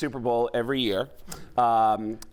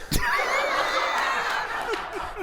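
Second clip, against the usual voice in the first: breathy gasp. Shown as a demonstration. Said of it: "This is the whole Mark Wahlberg impression".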